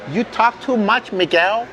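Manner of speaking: high, slow bossy bark; barking tyrant boss. Pretend. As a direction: scold the worker like a tyrant boss. Mock boss scolding worker.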